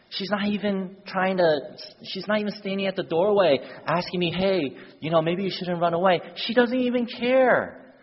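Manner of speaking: put-on high-pitched, aggrieved boy's protest; indignant complaint. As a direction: act out a hurt boy complaining, rising on the last line. Indignant boy complains about his mom.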